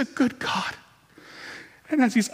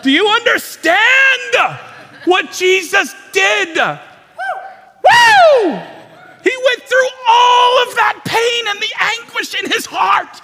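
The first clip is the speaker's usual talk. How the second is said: much higher and louder, slow shouted proclamation; preaching at full force; 13 semitones higher, 10 dB louder, slower than usual. Real, not acted. Preacher shouting the climax to the congregation, full force preaching. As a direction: roar it to the back of the church.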